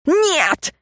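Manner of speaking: sudden high-pitched cry; squeal. A narrator's reading. Ignat refusing the thought, squealing. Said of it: "взвизгнул Игнат".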